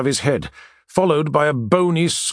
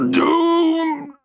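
croaked. A narrator's reading. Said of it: "Marcus croaked".

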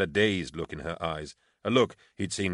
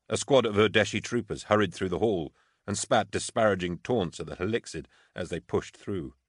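usual speaking voice

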